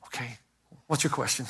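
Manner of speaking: hushed private thought while listening; quiet inner thought. Retold or imitated. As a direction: think it to yourself, hushed. Inner thought, rushed pastor.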